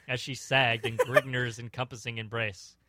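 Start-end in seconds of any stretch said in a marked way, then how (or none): none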